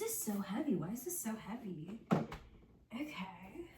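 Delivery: breathy whispered okay. Real, not acted. Vlogger gives up on the heavy item, whispers okay. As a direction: whisper it to yourself. Quietly to herself.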